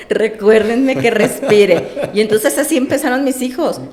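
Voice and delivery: can't hold back a laugh while telling it. Real, not acted. Mother laughing as she recalls asking kids for help.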